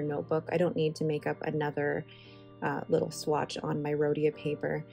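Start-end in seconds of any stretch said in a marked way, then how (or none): none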